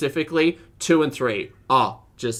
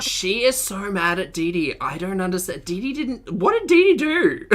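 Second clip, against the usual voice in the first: rising disbelief; 6 semitones higher than usual. Real, not acted. Host reacting to Chantel yelling at Dee Dee, incredulous squeal. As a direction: build from puzzled to shrieking disbelief.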